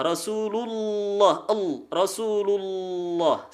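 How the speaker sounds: "Rasulullah" slow, with a long dark double l; thick lam. Shown as a demonstration. Teacher modelling lam after dhammah, thick held "Rasulullah". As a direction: dwell on the heavy l in "Rasulullah". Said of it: "lam dibaca tebal".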